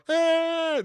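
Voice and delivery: high, loud mocking kid yell. Kids making fun of Big Bird, high mocking yell. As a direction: jeer like children making fun of someone. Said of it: "the kids are making fun of me".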